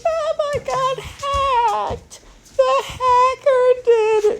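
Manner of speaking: very high, drawn-out mock wail; hammed-up squeaky bawling; crying. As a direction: imitate a kid sobbing in panic.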